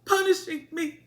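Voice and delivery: high-pitched put-on woe; mock lament. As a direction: wail it lightly, making fun of her. Mock quote of Alicent lamenting.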